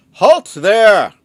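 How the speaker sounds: high, very loud command to halt; guard yells halt. Acted. Guard shouting at the strangers to stop, loud and high. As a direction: bark the halt like a startled guard. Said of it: "and he yells".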